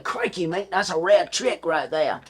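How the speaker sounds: playful imitation of an Australian; retelling jokes in an Australian accent. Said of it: "I started doing an Australian accent".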